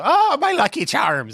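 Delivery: high, drawn-out mock Irish accent. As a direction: do a hammed-up leprechaun-style Irish accent. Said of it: "Can you do an Irish accent".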